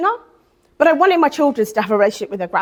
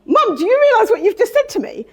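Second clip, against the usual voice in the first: much higher, stunned question to mum; shocked disbelief; 11 semitones higher than usual. Retold or imitated. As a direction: she can't believe what mum just said, pitched high. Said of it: "And I was like".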